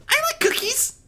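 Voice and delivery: high little filly voice, hopeful. Acted. Sweetbelle answering Discord, high and hopeful. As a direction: pipe up sweetly hoping for a treat. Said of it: "Sweetbelle spoke up hopefully".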